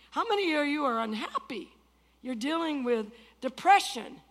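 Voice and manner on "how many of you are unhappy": ordinary talk, nothing marked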